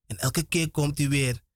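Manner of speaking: ordinary delivery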